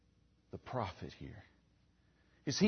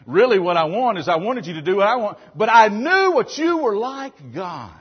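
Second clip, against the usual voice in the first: louder, fast and higher, a mock-peevish outburst at God; half-acted indignant rant, pitched up and pushed; Jonah's resentful complaint; 4 semitones higher, 9 dB louder than usual. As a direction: blurt it out like a sulking prophet caught out.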